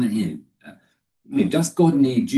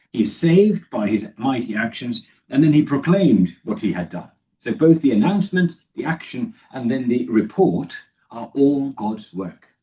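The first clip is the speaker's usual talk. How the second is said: usual speaking voice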